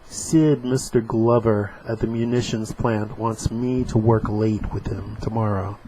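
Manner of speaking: comic strip voiced lightly; dramatic reading. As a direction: act out the panels. Reading comic dialogue aloud.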